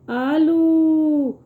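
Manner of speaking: "آلو" with a long stretched آ; long drawn آ in "آلو", teacher demo. Shown as a demonstration. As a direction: hold the آ of "آلو" slowly. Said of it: "اس کی آواز تھوڑی کھچ کے آتی ہے".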